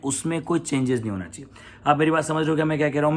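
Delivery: ordinary talk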